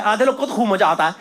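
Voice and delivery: usual speaking voice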